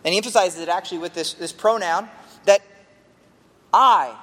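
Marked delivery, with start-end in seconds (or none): 3.7-4.2 I punched loud and alone; emphatic I. Shown as a demonstration. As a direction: hit the word I hard, then pause. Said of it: "and it's emphatic".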